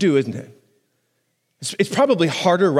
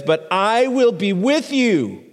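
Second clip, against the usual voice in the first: tender promise; 5 semitones higher, slower than usual. Acted. God's promise voiced.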